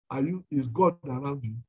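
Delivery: ordinary talk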